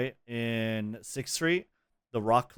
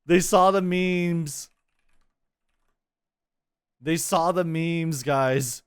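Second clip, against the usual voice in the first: excited; 4 semitones higher, 4 dB louder, much slower than usual. Real, not acted. Delighted reaction to the announcement.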